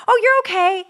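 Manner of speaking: high, light, brushing-it-off mom voice; mock casual mother to a fallen child; breezy mom. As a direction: imitate a mom shrugging off a fall. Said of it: "if she was just like".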